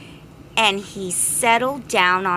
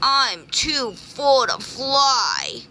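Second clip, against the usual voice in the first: slow, high whine; a mild mock wail; wailing bee; 5 semitones higher, slower than usual. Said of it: "wailed the greedy bee".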